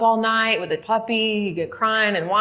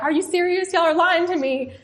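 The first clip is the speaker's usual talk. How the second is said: disbelieving joy; 11 semitones higher, 3 dB louder than usual. Retold or imitated. Disbelief at clemency news.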